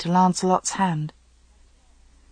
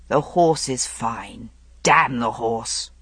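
low man's voice; 6 semitones lower, slower than usual. Acted. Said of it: "Arthur said".

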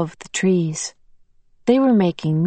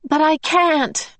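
high, slow anguished cry; cracking tearful heartbreak; 10 semitones higher, slower than usual. Acted. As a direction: wail it in anguish, near tears. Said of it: "cried in an anguished voice".

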